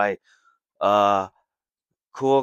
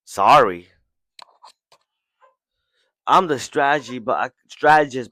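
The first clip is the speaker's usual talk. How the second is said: raised, louder cry of self-blame; distressed outburst; 3 semitones higher, 9 dB louder than usual. Retold or imitated. Ten, blaming herself after the defeat, loud and upset. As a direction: burst out in frustration at herself. Ten despairs over her failure.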